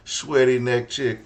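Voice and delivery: hyped joke. Real, not acted. Cohost hyped joking.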